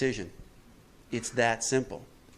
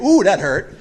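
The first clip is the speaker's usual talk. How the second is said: loud, higher mock wail of pain; mock whine; 6 semitones higher, 11 dB louder, slower than usual. Sinner running back to God, loud mock whine. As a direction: whimper it like a kid who fell.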